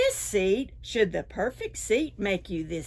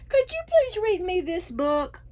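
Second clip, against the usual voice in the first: much higher, hopeful asking voice; pleading dragon; 9 semitones higher, 4 dB louder than usual. A narrator's reading. Dragon begging the raccoon to read, high and hopeful. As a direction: ask sweetly, hoping for yes.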